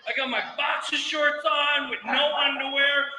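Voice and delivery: ordinary talk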